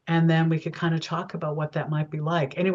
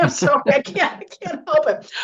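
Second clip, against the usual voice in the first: laughing apology; 10 semitones higher, 4 dB louder than usual. Retold or imitated. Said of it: "I'm going".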